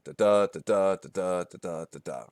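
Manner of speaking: da-da beats with the second stressed; iambic rhythm. Shown as a demonstration. Teacher demonstrating the iambic beat to students. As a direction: tap out the iamb, da-DA da-DA. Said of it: "five iambs together in a line".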